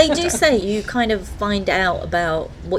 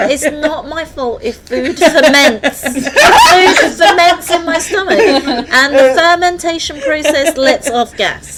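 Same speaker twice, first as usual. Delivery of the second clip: high, loud mock-defensive protest through laughter; giggling self-defence, raised voice; laughing protest; 7 semitones higher, 8 dB louder than usual. Real, not acted. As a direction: plead innocence while cracking up. Jokingly defending herself over farting.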